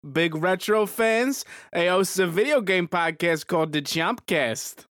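lightly played Italian mobster accent; a hint of an Italian mobster accent. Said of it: "strong Italian accent".

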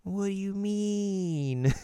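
confused Kagome. Retold or imitated.

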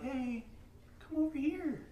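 soft, high, coaxing call; hammed-up gentle parent; nice tone. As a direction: coax a child over in a sweet little voice. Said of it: "a nice tone of voice".

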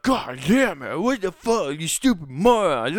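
high, loud angry outburst at the rider; furious cyclist. Retold or imitated. A cyclist swearing at the rider who cut him off, furious shout. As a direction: yell it like a furious biker who nearly crashed. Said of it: "he whips around like".

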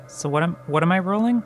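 ordinary talk, nothing marked

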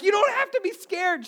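high, loud pleading; acted urgent reassurance. Father to sobbing daughter on log ride, loud frantic reassurance. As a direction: insist over a child's crying.

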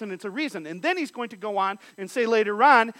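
ordinary delivery

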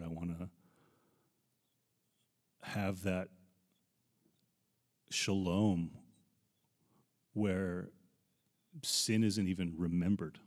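halting, choked-up longing with long pauses; getting emotional. Real, not acted. Pastor longing to be with the Lord, holding back emotion. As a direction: fight back tears, phrase by phrase. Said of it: "Sorry if I get emotional".

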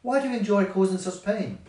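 mildly indignant bee; scolding. A narrator's reading. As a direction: scold her with a question. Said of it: "scolded Beryl".

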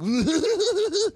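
high imitated clown laugh; clown cackle. Giant clown laughing at a kid, imitated high cackle. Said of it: "eight-foot clown going".

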